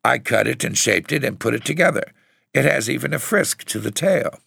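put-on high, quick, proud child; defiant. A narrator's reading. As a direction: rattle off your handiwork defiantly. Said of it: "Margaret spoke up defiantly".

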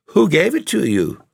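high, sharp jealous demand; jealous cry. A narrator's reading. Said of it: "cried the other".

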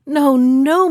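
loud, mildly protesting "no, no"; emphatic refusal. A narrator's reading. Princess refuses pet's offered sacrifice.

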